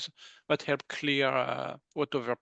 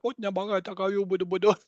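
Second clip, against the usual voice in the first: imitating light language; 5 semitones higher, 5 dB louder, faster than usual. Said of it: "they're like".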